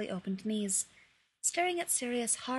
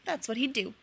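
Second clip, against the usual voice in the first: plain reading voice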